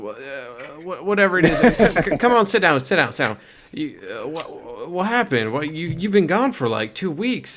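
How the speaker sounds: taken aback, voice jumping up; flustered. Acted. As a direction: trip over the words in a fluster. Flustered NPC greets missing friend.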